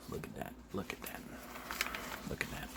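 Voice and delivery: very soft, under the breath; hushed repetition. Real, not acted. Narrator marvelling at a new arrival, very quiet repeats. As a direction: let it sink to a near whisper. Repeats an aside, dropping away.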